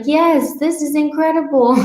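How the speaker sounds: high, gushing mock joy; mock elated. Dieter celebrating another drop on the scale, high mock elation. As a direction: cheer at the scale, hammed up.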